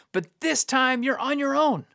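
pitched high, insistent refusal; emphatic refusal. Acted. Preacher voicing God abandoning unfaithful Israel, emphatic refusal. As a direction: wash your hands of them, high and emphatic.

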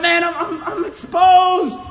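high, loud, stammered cry of realization; exposed, distressed. Retold or imitated. Listener realizing the lesson is about him, retold distressed cry. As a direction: build to a loud, stretched last word.

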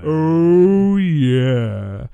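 hammed-up seductive drawl; sultry voice. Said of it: "our sultry, sultry voice".

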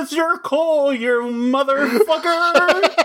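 high crying goofy warrior voice; Ninjor voice. Pretend. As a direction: wail it like a silly hero. Voicing Ninjor character.

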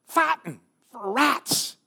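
high, loud Confederate soldier in a thick Southern drawl; a Southern soldier proudly declaring his cause; Southern accent. Acted. Confederate prisoner answering Union soldiers in a Southern accent. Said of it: "Sorry my, for my southern accent".

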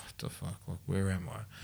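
stunned hush. Retold or imitated. Seeing the cells for the first time, stunned and soft. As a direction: breathe it out in disbelief.

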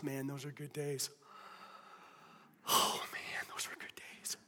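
put-on dreamy whispered sigh over the old days; nostalgic whisper. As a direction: breathe it like a fond memory. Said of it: "you thought to yourself".

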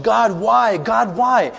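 preacher acting a hurting believer's lament; anguished why. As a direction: cry out why, twice, in mock despair. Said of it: "asking the question".

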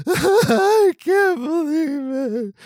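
high, slow, hammed-up sobbing lament; mock crying wail. Jealous podcaster fakes tears over a festival rejection, mock sobbing wail. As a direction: wail it like a fake meltdown. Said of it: "I wasn't really like".

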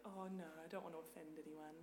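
soft, fast, anxious not to offend; timid apologetic. Retold or imitated. Australian worried about offending, soft and timid. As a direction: shrink back politely. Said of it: "you're like".

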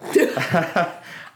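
high unvoiced laugh; sudden giggle fit; burst of laughter. Real, not acted. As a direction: crack up high and airy. Laughs at own joke.

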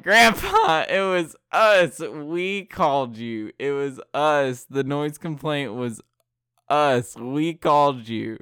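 loud, high, giddy shouting; gleeful confession. Retold or imitated. Kids gleefully yelling to grandpa they pranked him. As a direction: crow it out through laughter.

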